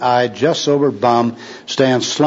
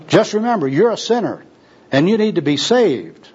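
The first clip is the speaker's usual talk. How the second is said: high-pitched preachy warning; parting admonition; 7 semitones higher than usual. Acted. Gospel worker's parting shot at tramp, preachy. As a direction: drive the last warning home from a raised pitch.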